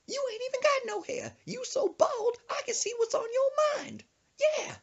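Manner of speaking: high sassy grandma roast; high mock grandma sass. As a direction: fire back the insult as granny.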